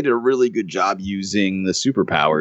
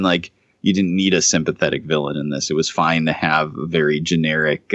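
ordinary delivery

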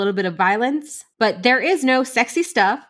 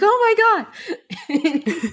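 high, drawn-out excited gasp; retold excitement; 9 semitones higher, much slower than usual. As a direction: replay your thrilled reaction, stretched out. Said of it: "I got excited".

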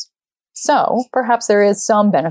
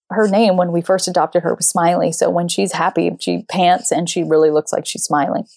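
ordinary delivery

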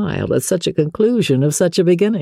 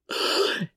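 sharp breathless gasp; a quick shocked intake of breath; 9 dB quieter than usual. Acted. Shocked listener in the library, sharp gasp. Scene of household uproar.

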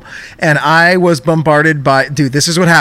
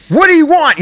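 high, louder snap at the caller; mock angry bark; 11 semitones higher, 4 dB louder than usual. Agent answering the call with a mock angry bark. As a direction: imitate a fed-up phone agent barking. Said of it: "the most angriest".

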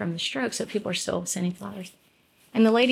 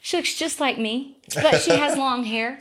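put-on child's voice; 6 semitones higher, slower than usual. Son's answer voiced by mom.

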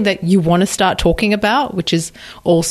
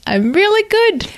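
much higher, playful lilt; high sing-song; 12 semitones higher than usual. Shown as a demonstration.

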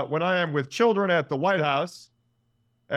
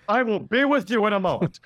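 higher, louder polite brush-off; Giamatti voice; 7 semitones higher, 4 dB louder than usual. Retold or imitated. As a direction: put the fan off politely. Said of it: "And I'm like".

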